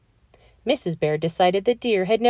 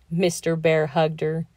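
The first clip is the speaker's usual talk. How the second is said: ordinary delivery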